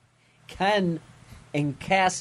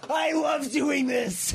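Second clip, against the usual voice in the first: gleeful villain; 14 semitones higher than usual. Retold or imitated. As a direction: yell it like a killer loving the carnage. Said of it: "he goes".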